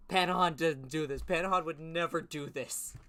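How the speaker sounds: loud, high outraged protest; indignant yell. Real, not acted. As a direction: insist Panahan would never. Indignant defense of character.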